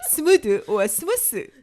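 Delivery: slow, loud "Smooth or smooth." mid-laugh; laughing through it. Real, not acted. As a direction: drag both versions out while laughing. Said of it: "Both wrong".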